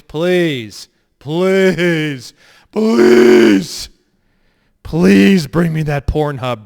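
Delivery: loud, drawn-out mock pleading; hammed-up begging. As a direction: beg at the top of your voice, hammed-up. Mock begging DMV to sue.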